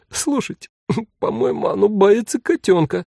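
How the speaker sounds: high, laughing while crying; laughing through tears. A narrator's reading. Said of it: "заикаясь от смеха и слёз".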